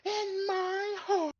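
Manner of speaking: very high, drawn-out wail; strained cartoonish scream; Carl Wheezer. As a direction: cry it out slowly in a high cartoon voice. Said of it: "that's not like Carl Weezer to me".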